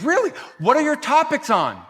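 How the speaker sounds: high, loud, keen questioning; eager husband. Retold or imitated. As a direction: imitate a husband pouncing on the topic.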